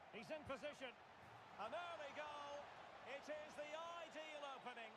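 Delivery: high, thrilled commentary, slowing at the end; excited goal call. Real, not acted. Commentator calling an early goal to viewers, excited.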